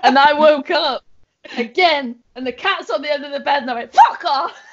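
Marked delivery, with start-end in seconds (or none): 3.9-4.6 higher, louder startled "Fuck off!"; startled shout. Retold or imitated. Telling friends how she yelled at the talking cat, startled shout. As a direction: yell it at the cat in panic. Retelling scaring off hallucinated cat.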